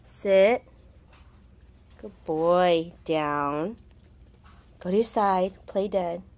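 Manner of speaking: loud, firm commands to the dog. Real, not acted. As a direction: call out the tricks to a dog.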